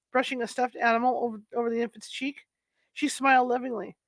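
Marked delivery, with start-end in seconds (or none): none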